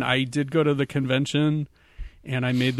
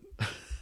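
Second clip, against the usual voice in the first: breathy laugh; 12 dB quieter than usual. Real, not acted. Laughing at own story.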